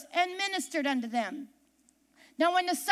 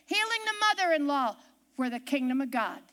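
ordinary delivery

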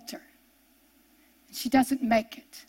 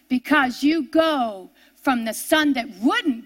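altar call appeal; 3 semitones lower, slower than usual. Real, not acted. Slow, quiet altar-call appeal.

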